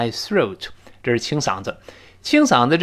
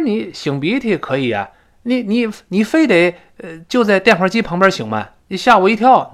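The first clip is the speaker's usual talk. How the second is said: annoyed complaint; 6 semitones higher, 4 dB louder than usual. Acted. 第一个人还不依不饶.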